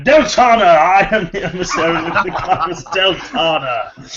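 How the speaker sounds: high booming envoy, laughing through; grand spirit voice breaking into laughter. Acted. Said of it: "the thing would have actually said that".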